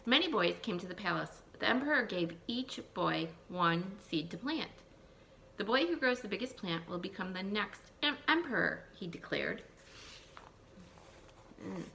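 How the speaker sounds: put-on lively read-aloud for young children; storybook reading. Teacher reading a folk tale to her class, lively storytelling.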